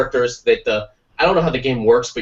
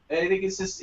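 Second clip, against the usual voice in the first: usual speaking voice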